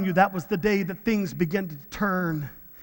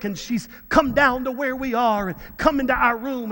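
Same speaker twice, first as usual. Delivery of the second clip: usual speaking voice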